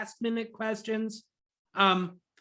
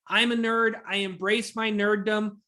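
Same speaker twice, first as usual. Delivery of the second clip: ordinary talk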